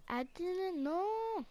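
put-on high defensive whine; mock defensive protest. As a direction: protest your innocence in a high voice. Protesting at being blamed, high voice.

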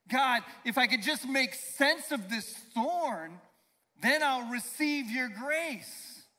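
loud, high-pitched fervent sermon; full-force preaching. Acted. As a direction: preach it out to the congregation at full power.

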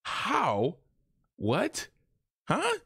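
high, climbing confused questions; stunned how what huh; baffled disbelief. Retold or imitated. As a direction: sputter in utter confusion. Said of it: "I would be like".

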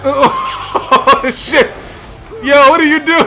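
amused outburst breaking into long laughter; laughing disbelief. Real, not acted. Player reacts to a botched play, laughing.

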